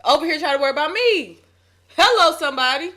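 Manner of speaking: high, loud preacher calling out; preaching. Real, not acted. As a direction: preach it to rouse the congregation. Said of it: "You preaching that thing".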